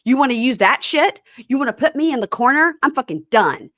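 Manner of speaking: mocking a furious husband storming off; mock furious yell. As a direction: ham up a man yelling he's done. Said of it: "Stands up very mad and says".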